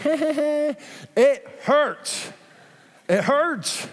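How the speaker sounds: high, laughing through the words. Real, not acted. Pastor laughing at men's Band-Aid pain, high and laughing.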